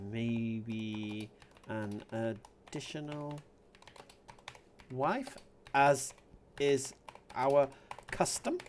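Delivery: halting, tentative composing aloud; hesitant suggestion. Real, not acted. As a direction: float it word by word, lifting at the end. Composing a provocative reply slowly.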